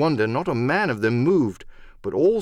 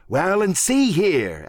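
high, drawn-out sea-dog voice; salty, slow emphasis; pirate cook Silver, emphatic; 8 semitones higher, 3 dB louder, slower than usual. Acted. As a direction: weigh in like a pirate leader. Silver joins the accusation.

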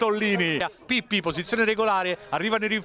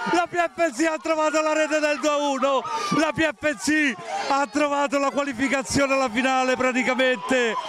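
breathless italian goal commentary. Real, not acted. Late winning goal.

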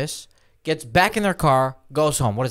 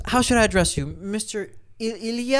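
lightly voiced, higher, overly polite stewardess; a hint of a prim flight attendant, pitched up; mimicking the stewardess; 7 semitones higher than usual. Retelling the stewardess addressing him, mock polite and higher. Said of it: "and she goes".

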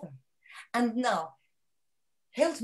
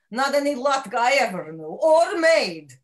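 indignant; 4 semitones higher, 10 dB louder than usual. Acted. Indignant about bad latkes.

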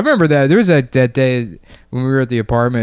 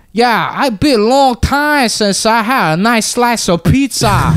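put-on higher, slow, louder mixed New York Southern accent; weird hybrid accent; 11 semitones higher, 4 dB louder, slower than usual. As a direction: drag it out in a swamp-rat New York accent. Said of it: "Weird swamp rat accent".

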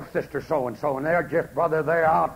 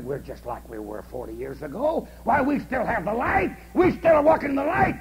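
smug folks; 7 semitones higher, 3 dB louder than usual. Retold or imitated. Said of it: "a lot of folks said".

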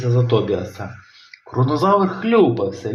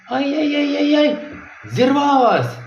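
high wailing ay-yay-yay; dismayed yelp; 13 semitones higher than usual. A narrator's reading. Robin losing his catch, high dismayed wail. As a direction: yelp as the line snaps. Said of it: "зойкнув Робін".

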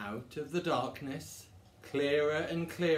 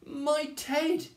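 high, loud, startled exclamation; gasped; 13 semitones higher, 6 dB louder than usual. Acted. Bear suddenly sees its lost teddy, gasping. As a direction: gasp it out in loud astonishment. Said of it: "gasped the bear".

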